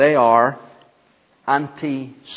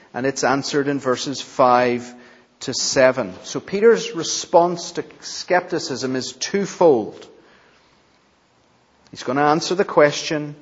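normal talking voice

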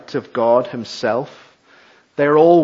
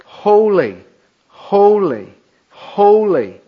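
ringing, louder proclamation of holy; proclaimed holy; 5 semitones higher, 5 dB louder, slower than usual. Acted. Preacher voicing the seraphim, proclaiming loudly. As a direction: call out each holy like an angel's cry. Seraphim calling to one another.

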